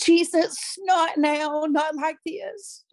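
high, strained cry reliving the panic; desperate high retold cry; retold scream. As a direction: cry out to God in alarm, fading to a slow plea. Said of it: "And I screamed".

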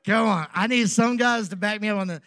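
ordinary talk

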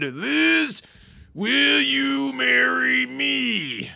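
very high, loud wrestler roar, stretched out; Macho Man. Acted. Telling the caller about his spouse, bellowing Macho Man. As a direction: build to a huge, drawn-out wrestler proposal. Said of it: "I'm doing my Macho Man as the best".

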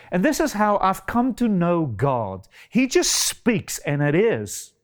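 ordinary delivery

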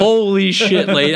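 high, loud mock disbelief; stunned reaction.

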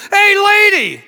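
loud, high parrot call; squawking parrot. Acted. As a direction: call out in a high-pitched bird voice. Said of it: "parrot did the same thing".

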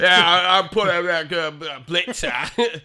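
very loud, garbled Cockney caricature; hammed-up Cockney accent, half laughing; mock Cockney accent. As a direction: blurt it out like an incoherent Cockney. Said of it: "Cockney accent in England".

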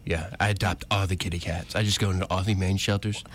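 lightly voiced rich braggart, running on quickly; quick, offhand presidential impression; Donald Trump. Host channeling the president off air for a co-host, lightly voiced impression. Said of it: "channel Donald Trump".

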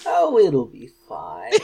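put-on slow old granny voice; creaky little old lady. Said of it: "That's gonna give you a sore throat".

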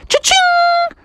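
very high ringing cha-ching; spear sound effect. Acted. Froggy throws the spear, high sound effect. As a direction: squeal out the spear's strike.

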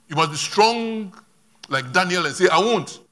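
emphatic exhortation. Real, not acted. Preacher urging the church to refuse like Daniel, loud and emphatic. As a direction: ring it out firmly, drawing out "strong".

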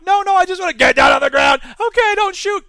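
loud, high, frantic pleading; panicked citizen. Acted. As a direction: beg the robot not to shoot.

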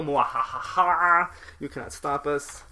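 slow hammy evil laugh and gloat; sneering panto villain. Pretend. Villain gloating after taking school.

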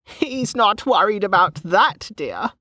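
put-on high, slow, knowing old woman; cackling old woman. A narrator's reading. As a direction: tease her slyly, pitched high. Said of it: "cackling again".

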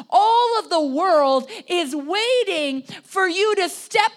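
loud, high, building pulpit proclamation; impassioned preaching. Real, not acted. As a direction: proclaim it with mounting force. Preaches at full force.

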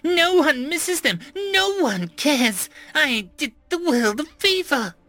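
high, slow, contemptuous villain voice; gloating villain. A narrator's reading. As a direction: sneer every word slowly. Villain gloats over his victims.

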